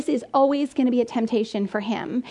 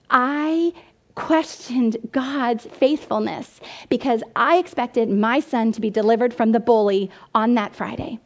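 ordinary talk

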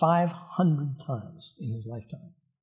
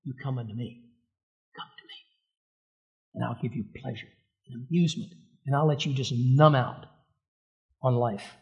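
the world tempting; 3 semitones lower than usual. Retold or imitated. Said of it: "Because the world ... is gonna go".

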